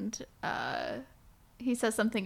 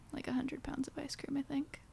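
whispering; 5 semitones higher, 9 dB quieter than usual. Real, not acted. Whispered boast aside.

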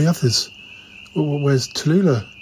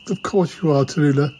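ordinary talk

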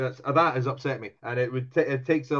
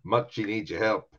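ordinary delivery

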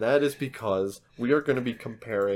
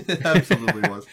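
laughing; 6 dB louder than usual. Real, not acted. Teasing host laughs.

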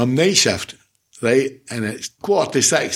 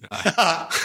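laugh; 6 semitones higher, 4 dB quieter than usual. Real, not acted. Laughs at own age joke.

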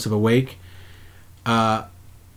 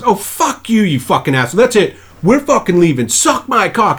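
high, loud mock rant at the ref; hammed-up outburst at the referee; imitating Will Ferrell yelling; 8 semitones higher, 3 dB louder than usual. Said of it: "He goes".

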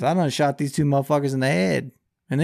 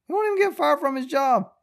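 much higher, disbelieving squeal on "won't even"; exasperated falsetto repeat of the line; incredulous high repeat; 14 semitones higher, 4 dB louder than usual. Real, not acted. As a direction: push it up high in outraged disbelief.

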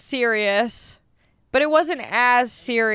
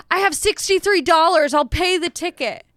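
higher-pitched defiant retort, retold; angry comeback; 6 semitones higher than usual. Driver to neighbour about the ticket, retold angry comeback. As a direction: fling it back defiantly. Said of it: "it made me so angry".

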